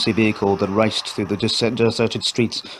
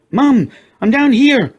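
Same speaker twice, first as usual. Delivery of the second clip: much higher, much louder, slow shout; 8 dB louder, slower than usual. A narrator's reading. Katie calls to her mother, much louder slow shout. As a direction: yell to be found. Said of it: "I shouted".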